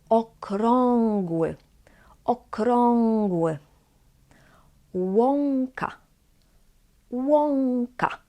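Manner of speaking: Okrągły and Łąka with ą said as "ong". Shown as a demonstration. Teacher models words to repeat, ą as ong. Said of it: "try to repeat after me".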